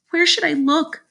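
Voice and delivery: put-on high young girl's question; upset girl. A narrator's reading. Sheila is told to find the teeth, high upset question. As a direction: ask helplessly in a high girl's voice.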